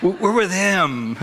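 high, slow comic voice, amused; lightly acted, drawn-out happy reply; men waving at king. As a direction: playfully call it out to a distant king. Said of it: "they're probably waving at the king".

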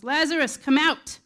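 ringing shout, summoning someone out; loud commanding shout. Acted. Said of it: "he shouted with a loud voice".

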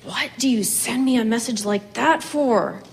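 ordinary delivery

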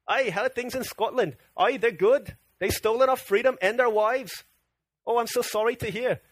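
hammed-up Scottish small talk; jokey mock-Scottish dialogue; mixed-up Scottish accent. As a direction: do a shaky amateur Scottish accent, drifting toward Irish. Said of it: "sound Irish or British or something".

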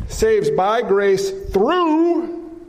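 through drawn out slowly and leaned on; slow, weighted through before faith; stress on through. Shown as a demonstration. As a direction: hang on the word through.

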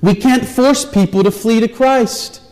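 high, loud, urgent preaching; full-force preaching. Acted. Preacher urging the congregation, loud and high. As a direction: plead with the crowd from the pulpit.

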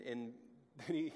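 ordinary talk, nothing marked

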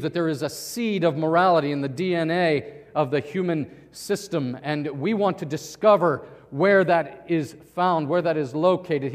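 loud, emphatic pronouncement; imitated forceful declaration. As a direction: hold forth like an assertive scientist. Retelling a scientist's forceful declaration.

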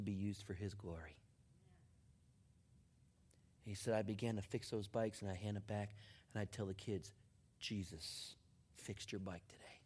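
hushed, low, reverent delivery; quiet, earnest sermon climax; solemn testimony preaching. Acted. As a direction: let it sink in softly, with long pauses.

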